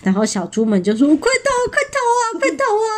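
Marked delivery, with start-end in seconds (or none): 1.2-2.9 high, rapid piglet alarm; fast storybook fright, pitched way up; mock panic. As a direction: squeal the warning in a rush. Piglets scream to run away.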